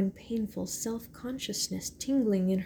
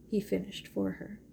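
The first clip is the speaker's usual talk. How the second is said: usual speaking voice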